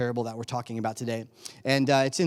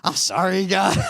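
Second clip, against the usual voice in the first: backtracking apology; 4 semitones higher, 4 dB louder than usual. Retold or imitated. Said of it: "you start backtracking".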